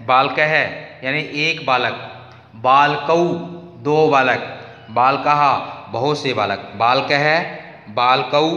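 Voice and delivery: rote recitation. Shown as a demonstration. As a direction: recite each form clearly for the class. Reciting declension forms aloud.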